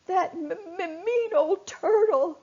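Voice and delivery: high, tearful stammer; stuttering crying. Acted. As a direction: choke on the words through tears. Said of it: "stuttered Finlock".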